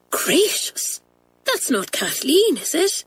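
high-pitched hushed alarm; horrified. Acted. Girl overhears friend crying inside, horrified. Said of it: "said Pat, horrified".

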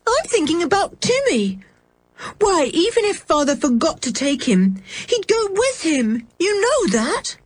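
put-on high, agitated child arguing; distressed cry. A narrator's reading. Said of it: "cried George".

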